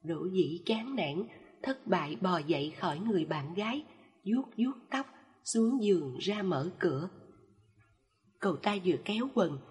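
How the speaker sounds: ordinary delivery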